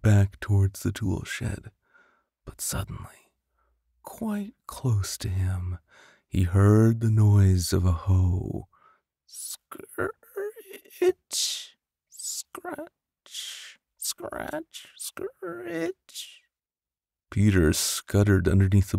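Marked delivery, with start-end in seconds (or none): 2.4-5.8 whispering. A narrator's reading. Sudden danger nearby. 9.2-16.4 high airy scraping sounds; scratchy hoe noise. A narrator's reading.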